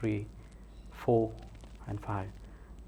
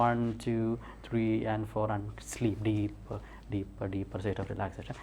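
slow count, sinking softer; hypnotic induction; 5 dB quieter, much slower than usual. Real, not acted. Hypnotist putting the subject back to sleep, slow hypnotic voice. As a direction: stretch the words and let them fade.

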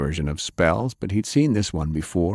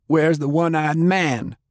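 shouted; 9 semitones higher than usual. Acted. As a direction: demand it, pitched up. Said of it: "Faye shouted".

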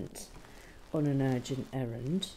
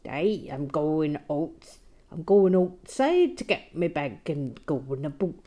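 a loud, hammed-up Canadian accent, "Hey" drawn out slowly; bad Canadian accent; 7 dB louder than usual. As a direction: ham up a terrible Canadian accent. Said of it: "just really bad".

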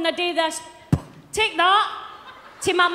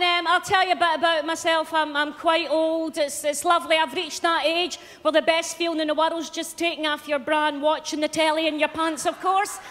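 ordinary talk

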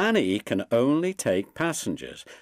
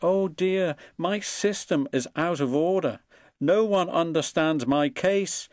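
moaning; 6 semitones higher than usual. A narrator's reading. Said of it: "moaned Henry".

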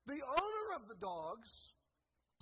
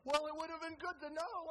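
exasperated retort; 7 semitones higher, 6 dB louder than usual. Retold or imitated. Exasperated husband retorts to wife.

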